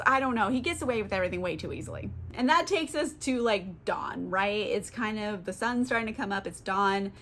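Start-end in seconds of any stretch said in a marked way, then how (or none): none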